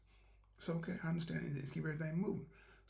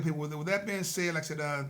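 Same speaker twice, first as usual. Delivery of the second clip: normal talking voice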